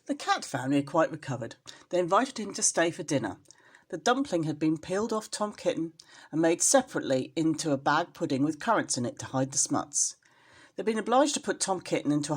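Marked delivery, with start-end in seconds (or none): none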